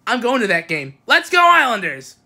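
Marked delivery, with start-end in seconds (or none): 1.1-2.2 high, rousing team chant; fan cheer. Real, not acted. Fan cheer ending the report.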